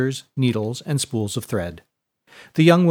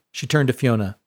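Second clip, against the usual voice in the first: usual speaking voice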